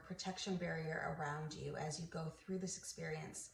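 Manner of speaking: soft, soothing meditation voice; guided meditation. Acted. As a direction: ease the listener gently into relaxation, softly.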